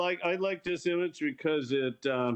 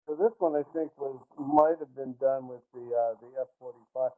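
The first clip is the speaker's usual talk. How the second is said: muffled, like through a pillow. Real, not acted. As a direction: voice smothered by a pillow. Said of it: "kinda sounds like you're talking through a pillow".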